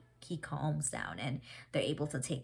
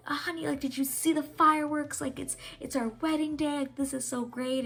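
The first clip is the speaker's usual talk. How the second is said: much higher, giddy imitation of the confused king; excited king voice; 10 semitones higher, 3 dB louder than usual. King George thinks it's his wedding day, giddy high imitation. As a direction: play the king, happily lost in the past. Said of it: "he's like".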